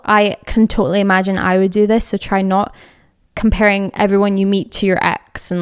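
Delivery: ordinary talk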